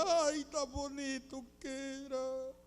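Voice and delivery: soft, drawn-out whimper of an abandoned wife; mock tearful pining. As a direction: coo pet names as if weeping, hammed-up. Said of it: "llorando y viendo la foto del marido". (lloriqueo fingido, cariñoso)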